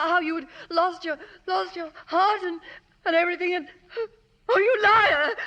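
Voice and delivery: rising from hurt to a crying outburst; tearful accusation. Acted. Rosie turns on Charlie, tearful then shouting. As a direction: voice breaks, then she hurls it at him.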